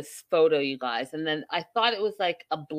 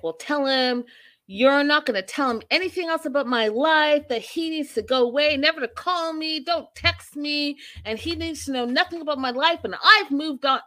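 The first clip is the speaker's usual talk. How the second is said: mock Darcy; 8 semitones higher than usual. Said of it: "Darcy's like".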